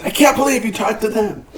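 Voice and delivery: high, amazed and a little breathless; husband amazed he spoke to the rock stars; amazed disbelief. Retold or imitated. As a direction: gasp it out in disbelief. Husband running over, amazed.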